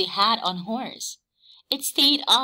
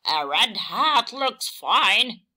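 slow, louder pig grunt; mild grunt; 4 dB louder, slower than usual. A narrator's reading. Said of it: "grunted Pig".

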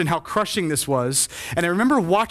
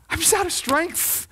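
high, drained plea; spent despair; 8 semitones higher than usual. Acted. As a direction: act out someone with nothing left to give. Voicing exhausted believer's cry to God.

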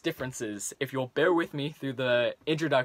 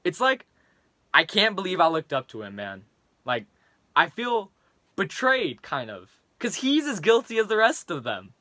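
put-on frustrated teen rant, building louder; Leon, angry rant; 4 dB louder than usual. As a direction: vent at a classmate, getting heated. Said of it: "in the following rant to his classmate".

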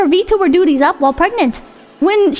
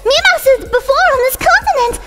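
high weeb voice; 6 semitones higher than usual. Acted. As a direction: rant it like an angry weeaboo. Reading weeb copypasta in voice.

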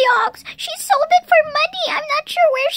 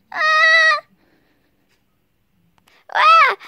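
mock yelp of falling; 3 semitones higher, 5 dB louder than usual. Child trips and yells.

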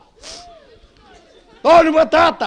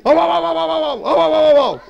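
dog barking; 3 semitones higher than usual. Pretend. Two hunters bark together.

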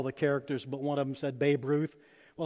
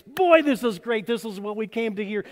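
higher and louder with delight; excited aliens; 4 semitones higher, 5 dB louder than usual. Retold or imitated. Preacher voicing aliens thrilled by the story, excited. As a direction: beam with eager joy. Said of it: "and the aliens were excited".

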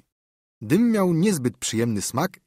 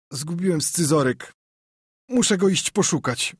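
put-on queasy boy's weak, higher voice; toneless; 6 semitones higher than usual. A narrator's reading. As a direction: speak thinly, hiding your nausea. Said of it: "Joe odezwał się bezdźwięcznie". (słaby, bezdźwięczny głos chłopca, wyżej)

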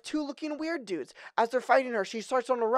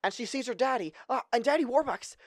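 ordinary talk

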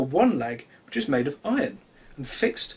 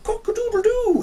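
very high, loud crowing call; rooster crow; 4 dB louder than usual. Acted. Cocky crows after his toast, very high and loud. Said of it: "he crowed".

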